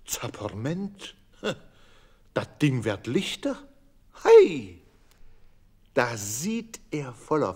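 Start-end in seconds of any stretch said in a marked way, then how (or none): 0.0-4.8 astonished farmer. Acted. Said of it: "verwundert steht und spricht er".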